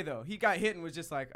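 ordinary talk, nothing marked

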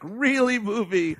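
high, disbelieving reaction; mock incredulity. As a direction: question the film as if let down by it. Acted viewers' reaction to film.

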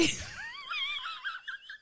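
high, helpless laughter; real high-pitched giggling fit; laughing fit. Real, not acted. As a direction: crack up mid-story. Cracks up at own quote.

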